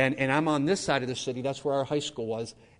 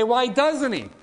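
a sharp, high, loud cry of why; pleading question; 10 semitones higher, 6 dB louder, slower than usual. Retold or imitated. Listener challenging God, a high loud protesting why. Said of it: "You say".

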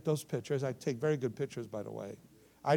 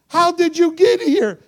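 high, loud, slow demand; imitated astonished shout; 16 dB louder, slower than usual. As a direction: shout it slowly in amazement. Astonished boy, then plain restatement.

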